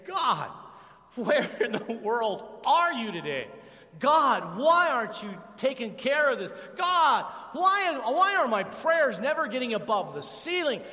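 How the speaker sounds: loud, high anguished plea to God; desperate prayer. Acted. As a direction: cry out to an absent God. Cries out to God.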